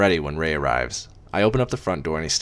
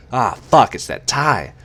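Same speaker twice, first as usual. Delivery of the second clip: shocked curse; 5 semitones higher, 7 dB louder, slower than usual. Acted. Seeing the tie.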